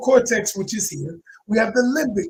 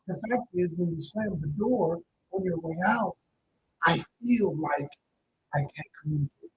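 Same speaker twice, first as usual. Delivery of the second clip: much softer, sincere fact-and-feeling statement; calm and quiet; 3 semitones lower, 6 dB quieter than usual. Shown as a demonstration. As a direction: speak gently about the slammed door. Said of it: "state the facts in a calm manner".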